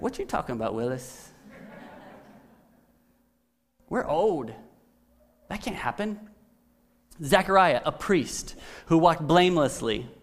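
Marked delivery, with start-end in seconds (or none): 0.0-6.2 mock incredulous quote. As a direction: play Zechariah as a sitcom skeptic. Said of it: "He's like".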